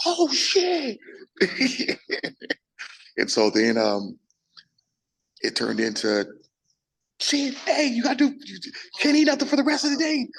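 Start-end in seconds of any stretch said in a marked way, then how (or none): 0.1-1.0 high squeaky "oh shit" of disbelief; stunned coach. Retold or imitated. Coach sees the screenshot and squeaks in shock. 1.0-2.5 high giddy laugh. Retold or imitated. Coach laughs after shock. 7.2-10.3 high, urgent imitated coach voice; excited coach orders. Coach tells the fighter to stop eating, high and urgent.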